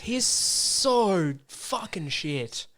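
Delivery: slow, drawn-out scorn; emphatic disgust. Real, not acted. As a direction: stretch it out in disgust. Arguing about Pegasus.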